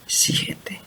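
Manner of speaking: slow hushed whisper of setenta veces siete. Acted. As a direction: breathe the verse in a low whisper. Pastor quotes Jesus.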